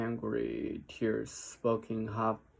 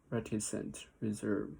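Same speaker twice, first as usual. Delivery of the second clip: plain reading voice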